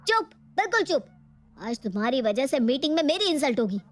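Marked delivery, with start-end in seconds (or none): 0.1-1.1 angry hush. Acted. Husband silencing his wife mid-argument, sharp and raised. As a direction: cut her off hard.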